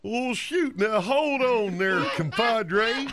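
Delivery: slow, hammed-up cowboy drawl; exaggerated, drawn-out cowpoke; bad cowboy. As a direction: stretch every word like a corny movie cowboy. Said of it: "I'll do a bad cowboy".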